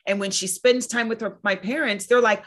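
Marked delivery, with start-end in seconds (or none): none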